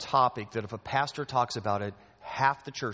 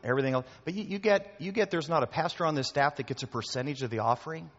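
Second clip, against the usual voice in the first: usual speaking voice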